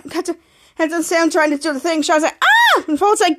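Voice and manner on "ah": very high, short "ah"; mock scream. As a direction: squeal in play alarm. Character in retold danger.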